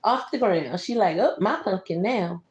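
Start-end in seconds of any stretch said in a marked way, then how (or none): none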